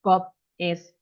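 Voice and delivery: BOB, IS, ON, THE, PHONE as separate words with gaps; choppy and mechanical, each word of "Bob is on the phone" cut apart. Shown as a demonstration. English teacher showing learners what not to do, word by word. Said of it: "not supposed to be said".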